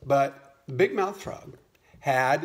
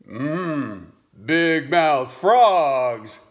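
very slow, drawn-out menacing alligator voice; sly alligator; 7 dB louder, much slower than usual. Acted. Alligator telling the frog it eats big mouth frogs, slow sinister drawl. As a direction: savor every word like a predator. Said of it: "alligator's punchline".

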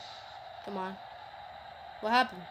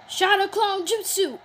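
loud slow battle cry; anime move call; 6 semitones higher, 6 dB louder than usual. Acted. Gamer calling out a jutsu as a loud battle cry.

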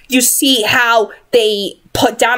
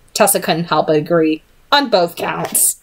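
ordinary delivery

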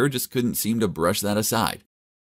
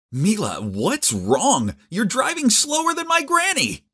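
high-pitched exasperated questioning, rising to a squeal; startled, high-voiced scolding; alarmed complaint; slower than usual. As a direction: climb higher as you protest. Startled awake, complaining to slow driver.

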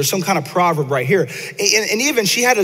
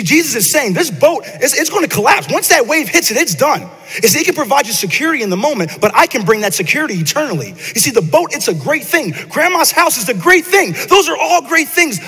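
preaching with rising intensity; 6 semitones higher than usual. Acted. Preacher to the church, rising into full preaching. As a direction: push it up like a preacher building to a peak. Builds up the sermon.

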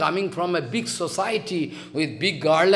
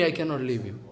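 usual speaking voice

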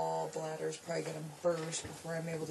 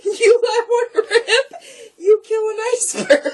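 laughing; 13 semitones higher, 18 dB louder than usual. Real, not acted. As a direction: choke out words laughing. Repeats joke cracking up.

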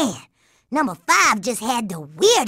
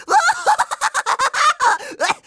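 evil cackle; 13 semitones higher than usual. Acted. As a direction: cackle triumphantly. Villain gloats at end.

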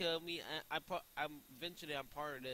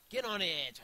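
higher, loud goading command; loud, raised-voice dare; urgent shout; 5 semitones higher, 11 dB louder than usual. Real, not acted. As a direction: yell it to urge them on. Urging escalates to a shout.

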